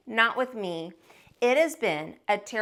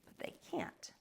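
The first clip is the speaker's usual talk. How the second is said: whispered, unvoiced stubborn aside; defiant whisper; 2 semitones lower, 16 dB quieter than usual. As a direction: breathe it out as a secret vow.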